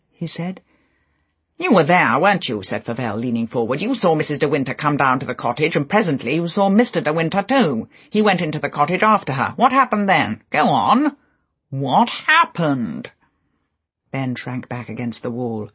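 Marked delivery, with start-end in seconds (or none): 1.6-13.1 Favell pressing hard. Acted. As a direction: badger him until he answers.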